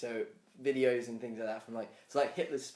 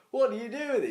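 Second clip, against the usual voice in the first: higher, louder puzzled alien; mock alien; 9 semitones higher, 5 dB louder than usual. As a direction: ask like a baffled alien. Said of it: "And he's like".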